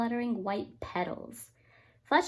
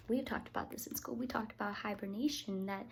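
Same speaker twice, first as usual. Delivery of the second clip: softly, as a quiet aside; whisper; 2 semitones lower, 9 dB quieter, faster than usual. Real, not acted. Parent whispering to the child mid-story, hushed. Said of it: "aside to child while reading".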